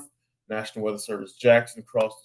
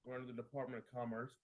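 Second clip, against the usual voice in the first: faint, as if mic muted; muted mic, barely audible; 21 dB quieter, faster than usual. Real, not acted. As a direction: speak from behind a muted mic. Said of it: "Uh-oh, you're muted".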